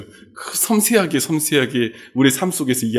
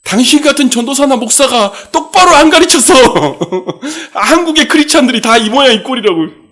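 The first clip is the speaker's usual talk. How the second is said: much higher, loud accusing yell, breaking into a laugh; finger-pointing tirade; 11 semitones higher, 7 dB louder than usual. Retold or imitated. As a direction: rant at the preacher while jabbing a finger. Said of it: "삿대질하면서 뭐라고 하더래요". (삿대질하며 높고 크게 따지는 고함)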